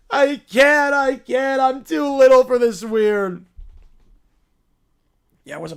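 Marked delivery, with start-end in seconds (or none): 0.1-3.5 louder whining yell; bratty meltdown shout. Acted. Spoiled character overwhelmed by the locals, shouting. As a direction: throw a full tantrum.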